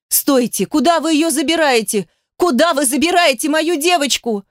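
high-pitched frantic pleading; desperate cry. A narrator's reading. Mother chasing men carrying her daughter off, desperate. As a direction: cry after them in rising panic.

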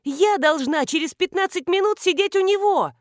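high, loud, frantic outburst; panicked exclamation. A narrator's reading. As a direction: cry out, alarmed at being late. Startled bride realises she is late.

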